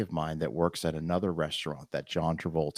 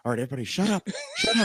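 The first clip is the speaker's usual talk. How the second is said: urgent hushing; 13 semitones higher, much faster than usual. Retold or imitated. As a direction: frantically order everyone to shut up. Hushing friends as phone rings.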